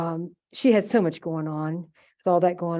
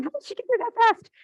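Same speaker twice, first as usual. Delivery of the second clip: very high mock squeal of disbelief; retold astonishment. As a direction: imitate a niece who can't believe it. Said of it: "She's going".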